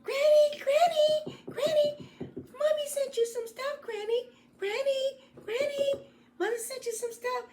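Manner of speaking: put-on very high, singsong little-girl calls; a very high child calling her granny. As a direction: call out eagerly at the door.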